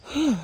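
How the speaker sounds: winded whew after a climb; heavy exhale. Real, not acted. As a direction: blow out a tired breath. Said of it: "Sorry if I'm out of breath".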